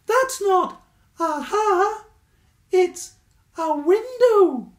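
high, loud squeaky badger voice; helpful Stuart. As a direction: chirp it up brightly and helpfully.

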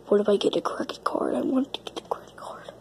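fast hushed whisper. Acted. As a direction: whisper it quickly, secretly. Secret plan whispered.

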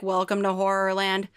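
plain reading voice

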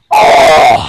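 hoarse, harsh play scream; agonized groan. Pretend. Toy victim being tormented, hoarse agonized cry. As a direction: let out a rasping mock-agony cry. Victim cries out under torture.

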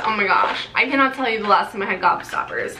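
ordinary delivery

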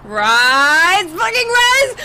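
loud, high frustrated yell of rise. Real, not acted. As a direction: scream rise at the balloon. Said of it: "when I yell at it".

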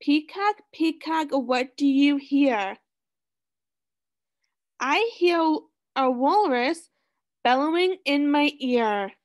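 sing-song storybook reading; reading to children. Acted. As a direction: read it like a picture book for kids. Reading picture book to class.

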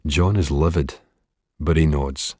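usual speaking voice

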